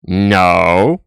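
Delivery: a close, heavy, flat "No." in a put-on voice; TikTok medic impression. Vlogger imitating the TikTok medic's close-up No, deadpan. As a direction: lean into the camera and say No like the TikTok medic. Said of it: "that American E M T on TikTok who just looks at the camera very closely and goes".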